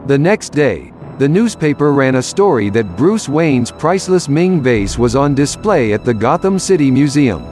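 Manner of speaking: usual speaking voice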